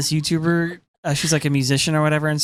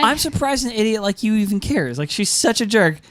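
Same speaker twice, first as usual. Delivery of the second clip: higher, sneering jab; mock sneer; 7 semitones higher than usual. Imitating Ymir mocking Sasha with a sneer. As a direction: look down on her while you say it. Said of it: "And then she goes".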